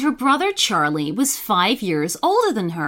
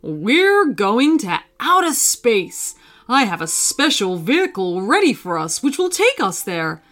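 father fox. A narrator's reading. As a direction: savour the surprise for the kids. Father fox announces the trip.

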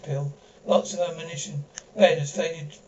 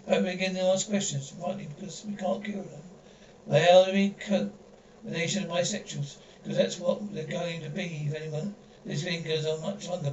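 ordinary talk, nothing marked

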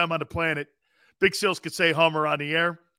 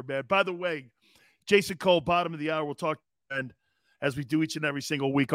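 ordinary talk, nothing marked

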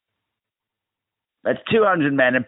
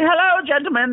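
high mock greeting. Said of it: "And I was like".

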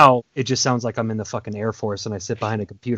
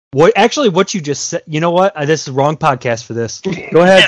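exasperated outburst; 3 semitones higher, 7 dB louder than usual. Real, not acted. Cohost bursts out at Dale, loud and exasperated. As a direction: blow up at him in disbelief.

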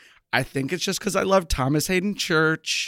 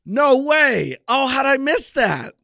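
high, excited disbelief; delighted surprise; 10 semitones higher, 5 dB louder, slower than usual. Real, not acted. Learning the actress was in a favorite film, high surprise. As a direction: exclaim it high, caught off guard.

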